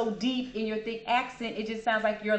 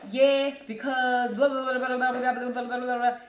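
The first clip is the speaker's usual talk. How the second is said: rattled off, higher and louder; mock rambling answer; 4 semitones higher, 6 dB louder than usual. To a rambler, mocking their long answer. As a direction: parody someone droning on.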